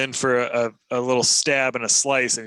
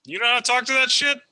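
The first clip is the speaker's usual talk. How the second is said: high, fast quip; character line; 12 semitones higher, 5 dB louder, faster than usual. Acted. Uhtred asking Largo about the goblin, high and fast. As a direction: toss it off high and quick. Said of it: "I'm gonna say".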